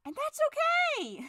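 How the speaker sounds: very high, slow sung okay; manic cheer. Acted. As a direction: cover the anger with a bright high sing-song. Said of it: "Falky's going insane".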